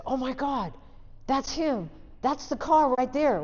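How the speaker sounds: blurting it out on spotting the car; excited recognition. Retold or imitated. Spotting the Jeep at work.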